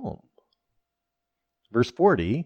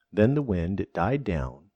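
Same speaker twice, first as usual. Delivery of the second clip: ordinary talk, nothing marked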